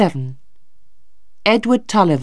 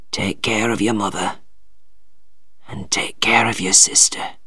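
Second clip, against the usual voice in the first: low, soft, fading to whisper; dying father; 7 semitones lower, 6 dB quieter than usual. A narrator's reading. Dying father entrusts family to his son, weakly. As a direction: let the voice fail toward breath.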